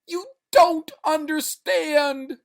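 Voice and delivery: very high, loud, slow scream; desperate scream. Acted. Man struggling up, desperate scream. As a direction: scream to be understood. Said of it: "trying to get up".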